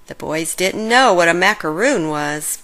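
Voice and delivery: usual speaking voice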